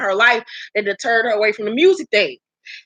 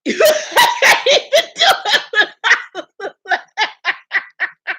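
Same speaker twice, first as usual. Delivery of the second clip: long high laugh running out of breath; helpless laughter; 11 semitones higher than usual. Real, not acted. Host unable to finish impression, long helpless laughter. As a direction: laugh on and on until it wheezes away. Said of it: "I gotta stop laughing".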